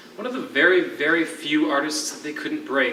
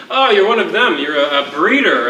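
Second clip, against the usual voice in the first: mock scorn; 5 semitones higher, 7 dB louder than usual. Mocking the prisoner's crime.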